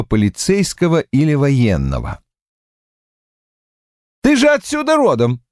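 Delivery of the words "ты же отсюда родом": high, pushy insisting voice; insistent. A narrator's reading. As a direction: press the point hard. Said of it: "гнул своё Полвард".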